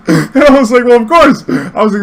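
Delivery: high, loud laughing through the words; real laughter breaking over the line; cracking up. Real, not acted. Cracking up retelling the story.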